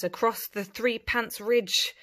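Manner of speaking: ordinary delivery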